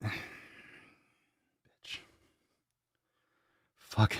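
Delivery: whispered curse under the breath; whispered swear. Real, not acted. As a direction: hiss it half to yourself. Muttered aside.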